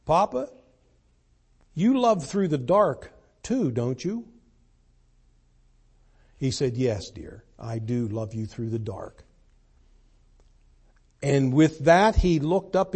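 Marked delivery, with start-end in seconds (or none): 0.1-4.3 lightly voiced little daughter asking her papa in the dark; little girl's question. 6.8-9.3 soft, low, reassuring reply; calm a frightened child; a hint of a gentle father, softer; soothing father. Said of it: "in the most soothing tone".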